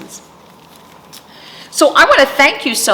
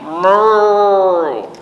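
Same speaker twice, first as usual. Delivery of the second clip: a drawn-out playful moo; cow moo; 3 semitones higher, 5 dB louder than usual. Shown as a demonstration. Librarian showing kids a cow's sound, playful moo. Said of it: "What sound does a cow make?".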